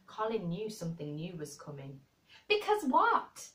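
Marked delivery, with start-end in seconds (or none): 2.5-3.5 much higher, louder and slower, eager; sick boy can't wait to hear; eager cry. Acted. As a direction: burst out eagerly. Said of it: "he cried eagerly".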